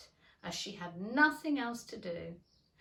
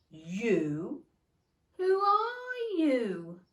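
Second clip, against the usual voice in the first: scornful; 4 semitones higher, 8 dB louder, much slower than usual. Acted. Caterpillar scornful question.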